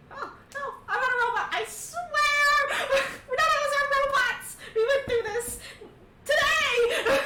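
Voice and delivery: high, frantic plea, near tears; begging them to believe he's no robot; panicked pleading. Acted. Mikhail panicking to the others, high frantic pleading. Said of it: "Mikhail's like".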